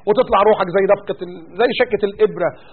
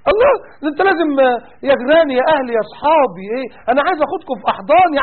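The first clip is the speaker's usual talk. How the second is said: mock cheerful; 4 semitones higher than usual. Mocking critics urging him to greet every woman cheerfully. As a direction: ham up a cheery friend urging hugs.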